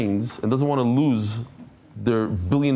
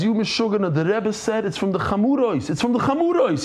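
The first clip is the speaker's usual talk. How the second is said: screaming in protest; 7 semitones higher than usual. Retold or imitated. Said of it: "He starts screaming".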